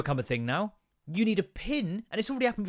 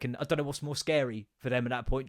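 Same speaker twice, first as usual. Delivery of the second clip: ordinary talk, nothing marked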